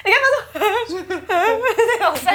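high, laughing complaint. Real, not acted. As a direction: complain while cracking up. (边笑边高声抱怨)

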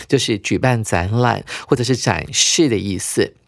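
ordinary talk, nothing marked

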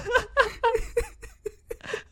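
high wheezing laugh near crying; helpless laugh that almost turns to crying; laughing to tears. Real, not acted.

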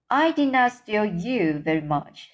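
usual speaking voice

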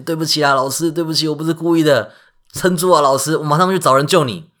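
panicked apology. Acted. Hercules apologizing to wounded teacher, frantic. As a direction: beg forgiveness in a rush.

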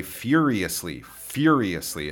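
"furiously" with FYUR leading, the rest light; stress on first syllable. Shown as a demonstration. Teacher repeats the word, "furiously" stressed at the start. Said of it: "Its stress is on the beginning sound".

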